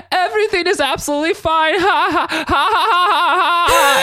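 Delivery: high, loud forced laughter through words; nervous fake laugh. [name] covering panic to Amanda, high forced laughing. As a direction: cackle nervously like a bad liar. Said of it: "[name]'s still going".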